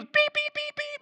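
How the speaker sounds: string of very high mock bleeps; imitated censor beeps. Preacher censoring Peter's swearing, high rapid-fire mock beeps. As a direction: bleep out a burst of cursing.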